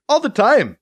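high, loud and eager; enthusiastic. Acted. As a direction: pipe up with excitement. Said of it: "pipes up enthusiastically".

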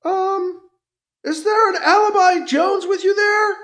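trooper calling from cover, unsure; distant trooper calling. A narrator's reading. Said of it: "A voice called out".

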